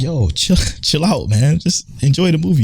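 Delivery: lightly voiced plea to settle down; mild retold telling-off; imitating fellow moviegoer. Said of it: "And then dude that's sitting next to me".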